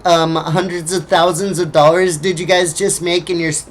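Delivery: usual speaking voice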